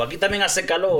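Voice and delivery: a Sevillian joking about the heat; lightly voiced Seville accent. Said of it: "Maracaibo y dijo".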